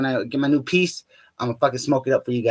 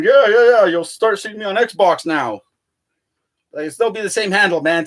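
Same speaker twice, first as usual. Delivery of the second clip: bragging; 4 semitones higher, 3 dB louder than usual. Retold or imitated. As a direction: show off to your friend. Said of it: "Is all brag to my friend".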